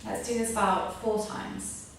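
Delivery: calm breathing guidance; yoga instruction. Acted. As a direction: lead the class through each breath. Guides a breathing exercise.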